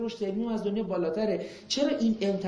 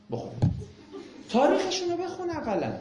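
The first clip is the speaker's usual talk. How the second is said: exasperated scolding; 9 semitones higher than usual. Real, not acted. Scolding critics of the West.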